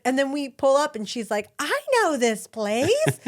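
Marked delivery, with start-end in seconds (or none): 1.6-3.2 high, drawn-out delighted recognition; excited child. Retold or imitated. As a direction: imitate a kid thrilled on arrival, slowly. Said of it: "she's all excited".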